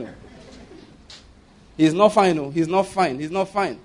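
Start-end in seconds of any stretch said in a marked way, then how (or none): none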